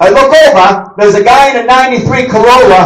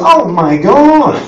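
usual speaking voice

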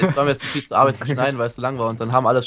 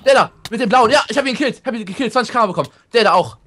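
high, loud, fast-paced call; excited report; 7 semitones higher, 4 dB louder than usual. Real, not acted. Player reporting his kill to teammates, high excited call. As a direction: blurt it out thrilled by the kill.

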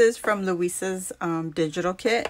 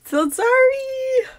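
mock apology; 4 dB louder, slower than usual. Real, not acted. Laughing apology after a slip.

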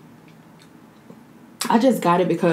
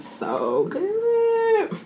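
high, slow, whiny "so good"; mock crying delight. Real, not acted. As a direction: wail it out like happy tears. Overcome by how tasty it is.

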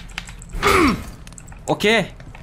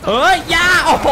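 startled yelp; 9 semitones higher, 5 dB louder than usual. Real, not acted. Grabbed by enemy.

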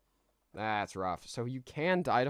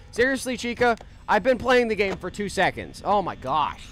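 exasperated outburst; 10 semitones higher, 9 dB louder than usual. Real, not acted. Jumpscared by Chica in game.

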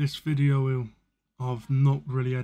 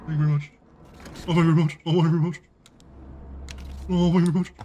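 hammed-up loud thanks; 3 semitones higher, 4 dB louder, much faster than usual. Repeated thanks, building.

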